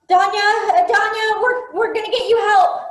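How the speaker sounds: high, loud calling out to her; frantic call to an injured friend; urgent reassurance. Acted. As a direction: shout comfort at the screen.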